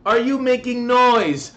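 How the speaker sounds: hammed-up complaining neighbour; mock neighbour complaint. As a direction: imitate her nagging. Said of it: "neighbour complaining".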